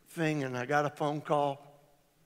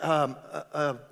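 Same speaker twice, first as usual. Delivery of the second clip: usual speaking voice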